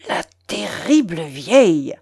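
muttered resentment through clenched teeth; through his teeth. A narrator's reading. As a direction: let it out tightly, as an angry aside. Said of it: "dit-il entre ses dents au général". (entre ses dents, avec rancune)

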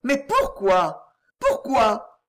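slow, pressing plea, rising to the second word; insistent cry. A narrator's reading. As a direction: demand an answer, bewildered and urgent. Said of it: "s'écria Geneviève".

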